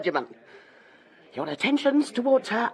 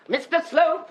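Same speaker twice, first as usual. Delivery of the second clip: sharp summons; 9 semitones higher than usual. Acted. Mrs Proudie summoning sharply.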